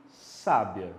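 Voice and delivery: "sábia" drawn out slowly, stress on "sá"; "sábia" with a long stressed first syllable. Shown as a demonstration. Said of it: "Quando o acento vem pra sábia".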